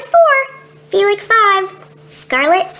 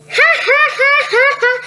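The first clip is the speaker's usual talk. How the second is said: high laugh; 11 semitones higher than usual. Acted. The laughing brother laughs at everything. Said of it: "Felix five laughs all the time".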